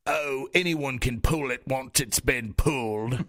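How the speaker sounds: slow put-on dopey voice; dumb older brother voice. Imitating Kay from Sword in the Stone, slow and dopey. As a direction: drawl it slowly like a big dim oaf. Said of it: "that's the voice I used for this business guy".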